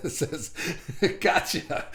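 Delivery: words broken up by laughter; cracking up mid-sentence; laughing while reading. Real, not acted. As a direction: try to read on through the giggles.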